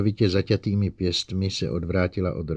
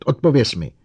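loud, high command; roared order; 6 semitones higher, 8 dB louder than usual. A narrator's reading. Bogert demands an answer from the robot, roaring. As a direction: shout for an answer. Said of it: "zařval Bogert".

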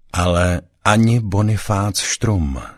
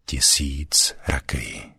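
hushed dread; 3 semitones lower, 4 dB quieter, slower than usual. A narrator's reading. Froš echoes the hissed threat.

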